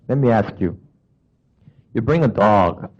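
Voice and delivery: usual speaking voice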